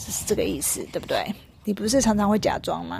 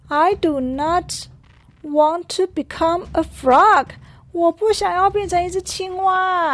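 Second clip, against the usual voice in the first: mock crying elephant; 7 semitones higher than usual. Elephant wailing that he doesn't want to be a frog. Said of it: "大象又开始哭了".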